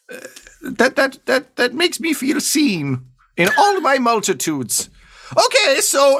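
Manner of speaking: a bookie with a tear rolling down his eye, way up high; the clerk feeling seen, voice lifted and slowed; high, slow, touched booking agent voice. Acted. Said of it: "and he says".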